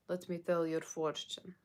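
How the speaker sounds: slow, lightly put-on Russian accent; a playful Russian fortune teller voice, slowly; Russian psychic accent. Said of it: "have myself as a Russian psychic".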